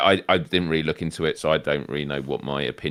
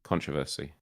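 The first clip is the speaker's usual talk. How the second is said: "Controversy" as CON-troversy, soft and low; stress on first syllable; 5 semitones lower, 11 dB quieter than usual. Shown as a demonstration. Guest copies the correction, soft low CON-troversy. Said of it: "emphasis on the wrong syllable".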